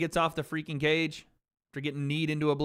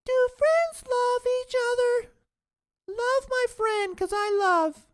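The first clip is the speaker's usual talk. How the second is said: very high, slow and louder, drawn-out mockery; mocking squeaky voice; 5 dB louder, slower than usual. Streamer ridiculing a viewer's love question, high and slow. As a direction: stretch it out in a silly high voice.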